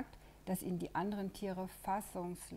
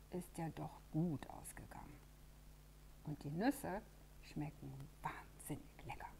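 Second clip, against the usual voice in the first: whisper; 5 dB quieter than usual. A narrator's reading. Narrator's cosy closing aside.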